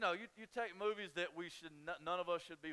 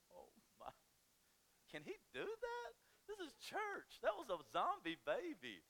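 high, scandalized gasp of a church lady; shocked churchgoer; 9 semitones higher than usual. Pretend. Pastor plays a shocked congregant, high scandalized voice. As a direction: clutch your pearls.

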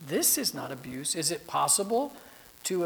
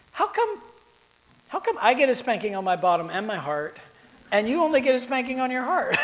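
put-on indignant child; 7 semitones higher, 7 dB louder than usual. Son protesting spanking unfairness.

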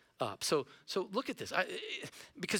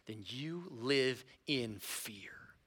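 slow hushed whisper, much softer; whispered warning; 5 semitones lower, 6 dB quieter, much slower than usual. Real, not acted. Pastor warning the congregation of fear, whispered slowly. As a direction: breathe it out like a dark warning.

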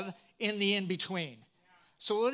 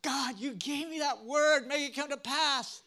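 a lightly voiced, high demanding prayer; praying demand; 6 semitones higher than usual. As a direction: press God for the promise. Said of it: "And here we are".

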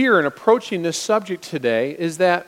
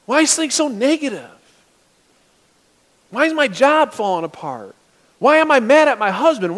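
rising, louder whining questions; mock frustrated complaint; 7 semitones higher, 4 dB louder than usual. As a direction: build to an exasperated why. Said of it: "And you're like".

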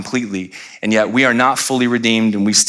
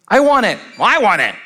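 put-on high, insistent kid voices; squabbling children; 11 semitones higher than usual. Two children fighting over one thing, high mock squabble. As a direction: demand it like a child, pitch way up.

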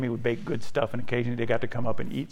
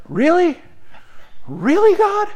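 high, disbelieving appeal to God; incredulous; 6 dB louder than usual. Retold or imitated. Believer questioning God, high and incredulous. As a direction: ask heaven in disbelief. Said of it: "And you're like".

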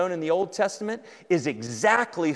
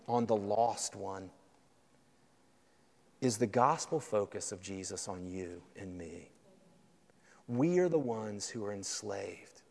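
low, soft, intimate sermon voice; hushed, earnest preaching; 7 semitones lower, 10 dB quieter than usual. Real, not acted. Preacher confessing to the church that all need Jesus, hushed. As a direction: bring it right down and confide it sincerely.

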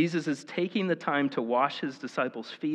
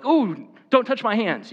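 sudden higher, louder mock protest; offended recoil; 7 semitones higher, 6 dB louder than usual. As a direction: recoil like the towel is an insult. Mock-offended diner refusing the towel.

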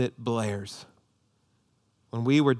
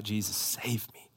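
slow, whispered plea to Jesus; whispered prayer; 4 semitones lower, 10 dB quieter, slower than usual. Acted. Modelled prayer, crying out to Jesus.

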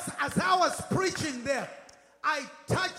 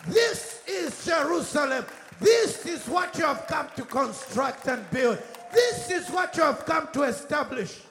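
impassioned pulpit exhortation, raised voice; driving, rousing sermon climax; full-force preaching; 2 semitones higher, 6 dB louder than usual. Real, not acted. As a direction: hammer each declaration at the congregation.

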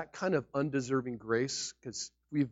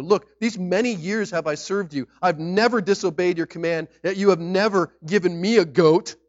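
louder, higher, aggrieved protest; older son protesting to his father, loud resentment; resentful complaint; 6 semitones higher, 11 dB louder than usual. Retold or imitated. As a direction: read it as the wronged older son, building up. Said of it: "he answered his father".

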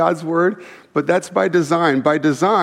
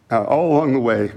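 ordinary talk, nothing marked

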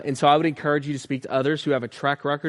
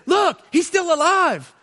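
much higher, slow, amazed exclamation; surprised dad; 13 semitones higher, 4 dB louder than usual. Retold or imitated. Dad sees the turtle move.

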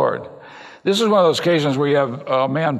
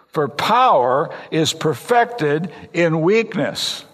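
slow emphatic reading; 2 semitones higher, much slower than usual. Shown as a demonstration. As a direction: slowly lay out each word of "for power is perfected in weakness". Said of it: "You get that?".

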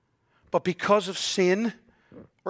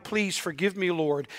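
usual speaking voice

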